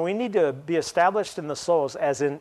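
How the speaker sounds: normal talking voice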